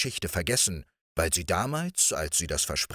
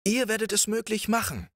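much higher prince, confident; assuring prince; 14 semitones higher than usual. A narrator's reading. Riquet assures the princess, much higher. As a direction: assure her with delight. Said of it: "versicherte Riquet".

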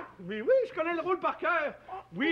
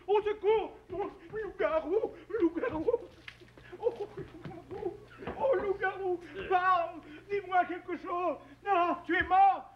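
higher, urgent calls to a fallen friend; a touch of panic; 6 semitones higher than usual. Acted. Friend frantically calling her fallen partner, higher. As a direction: cry out, fearing he is hurt.

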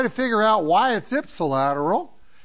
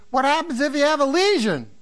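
higher-pitched imitated student; back-row student; 7 semitones higher, 3 dB louder than usual. Medical student questioning the lecturer, imitated high voice. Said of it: "some medical student in the back row said".

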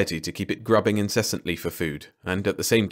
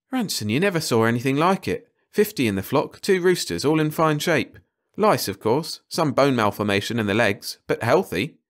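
higher, eager character voice; excited ecologist; 5 semitones higher than usual. Acted. Ecologist bursting in to tell Ranson about the flock, excited. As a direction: gush about a find in a raised pitch.

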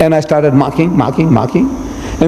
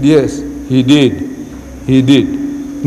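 slow, lower-pitched concession; slow reluctant admission; 4 semitones lower, much slower than usual. Retold or imitated. Cornered Maulana answering the Reverend, slow and reluctant. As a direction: concede it slowly, word by word. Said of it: "So the Maulana started slowly".

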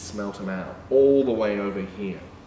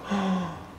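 quick high gasp of amazement; surprised gasp; 7 semitones higher, 4 dB quieter than usual. Pretend. Turning the page for children, gasping. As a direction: gasp for the kids.

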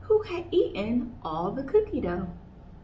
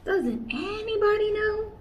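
much higher, exasperated; pleading question; 12 semitones higher than usual. Acted. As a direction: plead with everyone. Kanga pleading.

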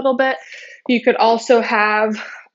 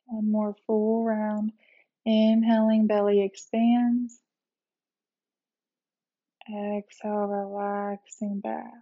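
guided meditation; 4 dB quieter than usual. Real, not acted. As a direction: ease them gently into rest, unhurried. Leads a guided relaxation meditation.

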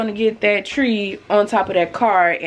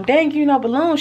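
quoting friend; 7 semitones higher than usual. Retold or imitated. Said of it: "She like".

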